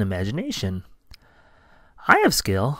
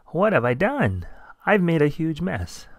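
ordinary delivery, nothing marked

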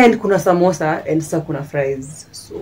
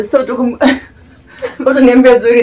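laughing; 7 semitones higher, 8 dB louder than usual. Real, not acted. Talking while laughing.

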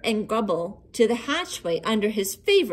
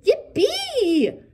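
high, loud delighted yelp; joyful cry; 13 semitones higher, 5 dB louder than usual. A narrator's reading. Hughley finds the world still there, cheering. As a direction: shout for joy. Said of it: "cried Hughley".

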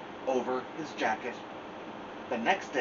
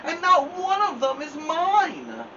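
much higher, louder angry scream, slowing at end; 14 semitones higher, 6 dB louder than usual. Acted. Angry Toad screaming, much higher and louder. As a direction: shout it at the whole world.

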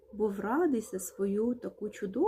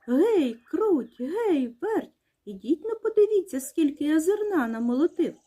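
raised, high rooster call to the mice; proud storybook summons; 5 semitones higher, 4 dB louder than usual. A narrator's reading. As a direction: holler for them to come and see. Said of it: "та й гукає мишенят".

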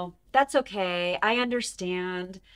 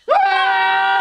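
loud, high, hammed-up shout; let it all out; 9 semitones higher, 10 dB louder than usual. Shown as a demonstration. Host shows kids how to shout out frustration. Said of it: "just let it all out".

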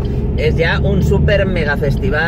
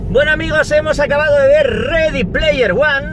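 excited intro; 10 semitones higher, 5 dB louder than usual. Real, not acted. Post-film intro, upbeat.

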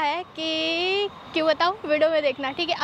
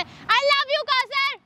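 very slow, higher, drawn-out sweet tease; teasing; 8 semitones higher, much slower than usual. Real, not acted. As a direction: stretch it out to annoy him. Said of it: "मैं irritate कर रही हूँ". (चिढ़ाते हुए धीमे, ऊँचे सुर में)

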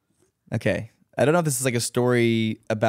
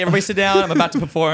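high, loud showman announcement; grand announcement; 8 semitones higher, 6 dB louder, much faster than usual. Pretend. Dancer hamming up a party performance, loud high showman call. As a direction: command the room before the show. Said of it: "I'm like".

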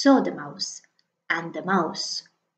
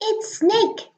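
much higher mouse, bright and quick; little mouse voice; 12 semitones higher than usual. Acted. The mouse announcing Snake, high little voice. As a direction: pitch it up like a tiny mouse.

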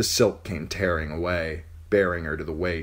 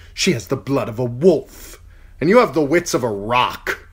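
heated retort; 7 semitones higher, 8 dB louder than usual. Acted. Heated exchange.

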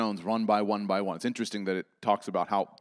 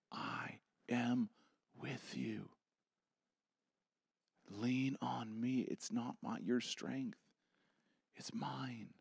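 breathy whisper, much softer; soft sacred whisper; intimate whisper; 10 dB quieter, slower than usual. Acted. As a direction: whisper God's promise close. God's answer whispered.

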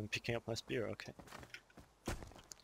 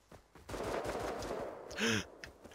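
sharp gasp; 11 semitones higher than usual. Real, not acted. Watching another player get destroyed.

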